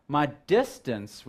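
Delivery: "distance" drawn out slowly for emphasis; stress on DISTANCE. Real, not acted. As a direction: lean hard and slow on "distance". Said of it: "and I emphasize that word".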